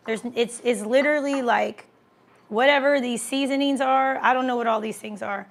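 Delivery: usual speaking voice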